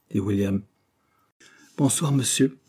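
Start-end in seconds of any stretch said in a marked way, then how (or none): none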